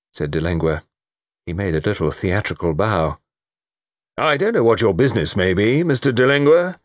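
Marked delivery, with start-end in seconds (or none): 4.2-6.8 sharp, higher-pitched challenge; brusque colonel's rebuke. A narrator's reading. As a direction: cut the visitor short, rising on the name. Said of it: "said Colonel Melrose sharply".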